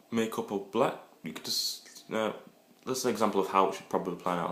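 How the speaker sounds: normal talking voice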